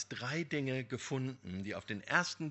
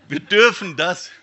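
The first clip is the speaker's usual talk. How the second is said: laughing; 6 semitones higher, 17 dB louder than usual. Real, not acted. Laughs after the story.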